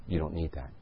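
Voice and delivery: soft, low murmur of a gentle inner voice; a quiet nudge from within, barely there. Retold or imitated. Preacher voicing God's first soft nudge in the store, quietly.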